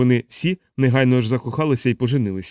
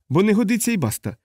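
high, insistent final word; emphatic refusal, pitched up; firm; 8 semitones higher than usual. A narrator's reading. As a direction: close the argument firmly. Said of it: "твердо повторив Крісті".

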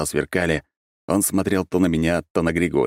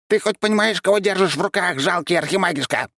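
much higher, scornful little monster voice; haughty creature; 14 semitones higher than usual. A narrator's reading. Tiny creature sneers at the archmage holding it, high and haughty. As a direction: sneer down at him from a tiny body.

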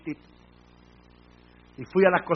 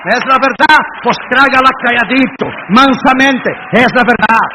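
loud, emphatic pulpit challenge; forceful preaching; 4 semitones higher, 11 dB louder than usual. Real, not acted. Preacher telling the church to swallow the truth, forceful. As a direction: drive each phrase at the congregation.

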